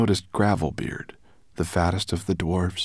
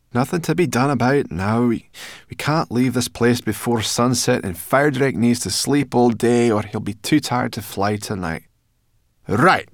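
loud, raised character voice; brisk-minded brownie, pitched up and forceful; bossy brownie; 6 semitones higher, 8 dB louder than usual. A narrator's reading. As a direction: take charge as a pert little brownie. Sorrel takes charge.

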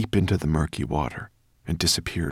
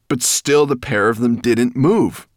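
ordinary delivery

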